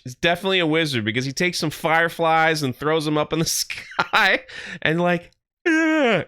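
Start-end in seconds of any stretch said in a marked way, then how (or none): 5.7-6.2 high, short mock grunt; imitated grunt. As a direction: grunt like someone who can't talk. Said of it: "that's how he talks".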